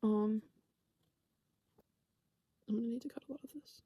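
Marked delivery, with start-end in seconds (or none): 2.7-3.8 fast, soft whisper aside; quick whispered editing note. Real, not acted. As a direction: mutter a note for the edit. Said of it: "editing aside".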